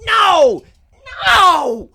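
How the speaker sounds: very high, loud, drawn-out screech; snapping outraged woman. Retold or imitated. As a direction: imitate a heckler losing it, each word stretched. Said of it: "absolutely snaps and goes".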